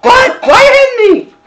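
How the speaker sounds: very high, loud mock protest; startled kid. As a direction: squeal it like an indignant kid. Kid startled by parent's knock.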